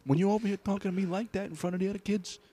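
put-on Italian accent. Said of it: "a really Italian accent".

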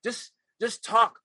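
loud, high, commanding coach voice; a coach cutting in on a student's scene, loud and high; stern acting coach. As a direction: order him to just say the lines. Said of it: "She said".

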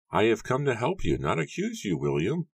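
high-pitched, gentle character voice; high, mild voice rising at the end; soft-spoken Matthew. A narrator's reading. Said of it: "Matthew said softly".

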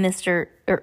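ordinary delivery, nothing marked

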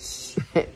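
a quick unvoiced laugh escaping while reading; stifle a little laugh at the text; soft breathy chuckle. Real, not acted. Reader cracking up at the sock line, short airy laugh. Reader breaks up mid-sentence.

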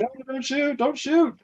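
high-pitched mock surrender cry; mock pleading. Retelling surrender to armed cops.

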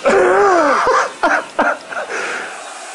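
long squealing laugh; high laugh. Real, not acted. Teller laughing at the flood noise, long high laugh. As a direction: let a high laugh run out.

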